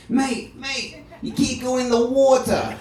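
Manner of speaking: high voice with a hint of an Australian accent; a light Australian lifeguard warning; lightly Australian lifeguard. Second lifeguard at the pool.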